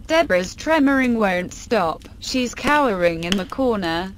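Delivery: usual speaking voice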